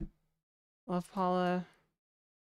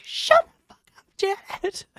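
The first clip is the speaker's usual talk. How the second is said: yelled much higher and louder, then a hissed whispered name; snap, then spit the name out; brother snapping; 13 semitones higher, 8 dB louder than usual. Retold or imitated. Younger brother finally snapping at his annoying sister, furious yell. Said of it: "he just snapped".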